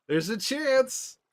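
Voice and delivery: high, drawn-out tease; mock sly actor, repeated. Streamer repeating Pedro's teasing line, slow and high. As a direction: stretch out There's a chance knowingly. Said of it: "he's got this look on his face where he's like".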